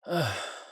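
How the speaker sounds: high, falling sigh; weary sigh. Acted. Recovering vampire to companion, weary sigh. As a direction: heave a short, weary sigh.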